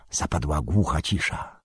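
ordinary delivery, nothing marked

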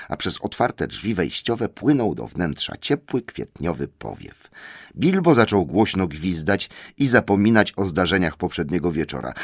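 ordinary delivery, nothing marked

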